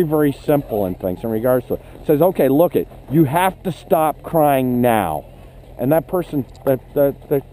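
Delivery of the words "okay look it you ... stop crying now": put-on slow, deliberate and firm to a crying child; firm direct instruction. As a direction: lay down the rule calmly, word by word. Instructor firmly tells crying child.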